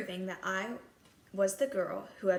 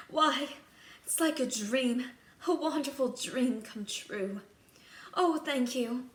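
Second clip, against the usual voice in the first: higher, louder, wondering thanks; awed delight; 4 semitones higher, 4 dB louder than usual. Acted. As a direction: breathe out wonder at the dream. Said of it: "I said".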